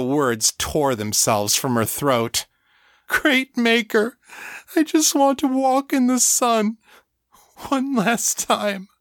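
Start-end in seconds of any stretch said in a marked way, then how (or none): 3.1-8.9 anguished high wail; sobbing. A narrator's reading. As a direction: plead through sobs, pitched way up.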